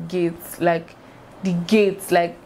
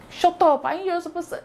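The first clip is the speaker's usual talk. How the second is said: mom's furious shout; 11 semitones higher than usual. Retold or imitated. Mom yelling at her daughter on the phone, high mock fury. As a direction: snap at the kid like a mad mom. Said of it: "She shout".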